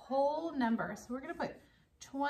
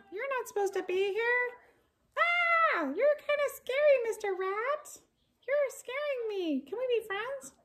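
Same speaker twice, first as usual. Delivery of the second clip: much higher, frightened puppet voice; timid puppet wary of the rat; scared puppet; 11 semitones higher than usual. Acted.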